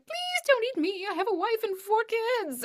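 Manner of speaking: hammed-up broccoli begging not to be eaten; begging food voice. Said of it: "about to take a bite of your own food".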